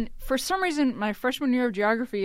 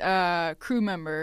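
normal talking voice

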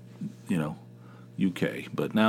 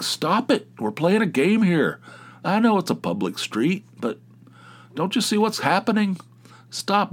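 high, raised voice at the cars; kid yelling; 9 semitones higher than usual. Retold or imitated. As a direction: shout at the cars from the street.

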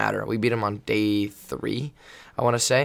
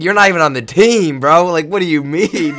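loud, high-pitched retold protest; incredulous retort; 7 semitones higher, 11 dB louder than usual. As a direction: act out arguing back in disbelief. Retells arguing with an opposing player.

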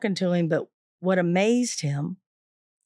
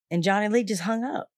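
ordinary talk, nothing marked

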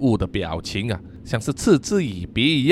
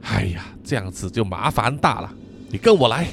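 low, softer sigh of trouble; 5 semitones lower, 3 dB quieter than usual. Acted. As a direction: let out a heavy sigh before the bad news. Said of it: "叹了气说". (叹着气低声说)

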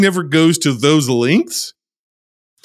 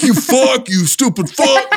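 much higher, mock shouted insult; villain shouting; 12 semitones higher, slower than usual. Podcaster imitating the Cliffhanger villain, mock shouting. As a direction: bark it like the walkie-talkie villain. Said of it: "he's the one that's always shouting".

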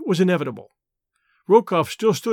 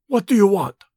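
ordinary delivery, nothing marked

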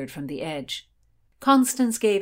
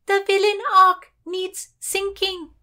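high, loud, slow pronouncement; shouted command; 14 semitones higher, 7 dB louder than usual. A narrator's reading. Lady on ship condemns the small boat, high commanding shout. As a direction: declare it slowly at a shout.

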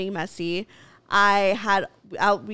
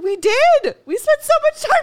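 much higher and louder, hammed-up protest; mock-indignant, pleading her case; exasperated protest; 8 dB louder than usual. Telling her boyfriend they spent the whole weekend together, higher and louder protest.

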